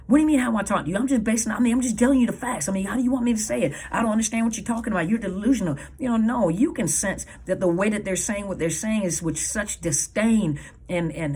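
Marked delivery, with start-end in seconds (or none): 0.1-5.8 indignant, higher-pitched comeback; fast, higher, dismissive protest; defensive narcissist. Acted. Narcissist brushing off a partner's complaint, defensive.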